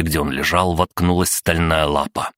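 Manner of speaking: usual speaking voice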